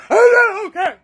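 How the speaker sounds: high, loud sobbing voice; ugly crying. Retold or imitated. To the police officer after the escape, a high loud sobbing wail. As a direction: blubber it out through tears. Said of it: "I'm ugly crying".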